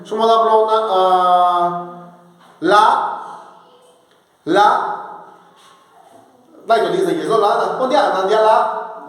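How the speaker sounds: "la" sung out on a high level tone; high tone demo. Shown as a demonstration. Teacher shows students the high tone on "la". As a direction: hold "lo la" on a steady high pitch. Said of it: "¿Cuál es el alto?".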